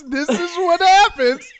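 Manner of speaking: loud, high, slow point-making; catching friend in the act, emphatic and slow. Real, not acted. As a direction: spell it out for the listener.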